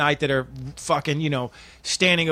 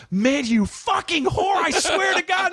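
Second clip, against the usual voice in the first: much higher, slow then yelling; furious shout; slower than usual. Acted. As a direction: rage at the cheating girlfriend. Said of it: "I go".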